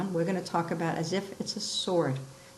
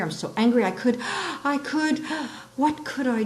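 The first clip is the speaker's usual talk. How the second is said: mock anger; 6 semitones higher than usual. Shown as a demonstration. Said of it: "I'm thinking of some students".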